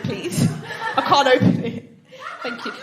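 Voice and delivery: ordinary talk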